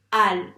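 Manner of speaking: al lancé fort; sound isolated. Shown as a demonstration. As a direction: marquer al. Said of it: "il manque le". (son al prononcé fort)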